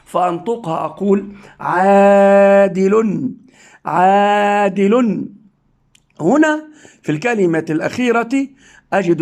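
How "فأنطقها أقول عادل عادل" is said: عادل read slowly as aadilun; slow reading with nunation. Shown as a demonstration. Teacher modelling tanween on عادل, slowly. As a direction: stretch عادل and end on n. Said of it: "رأيت ضمة زائدة".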